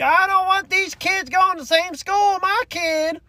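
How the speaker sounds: very high-pitched imitation of a screaming adult; mock screaming. Imitating adults screaming at sick children, screaming. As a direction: screech it like an outraged parent. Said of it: "having adults screaming at them, bloody murder".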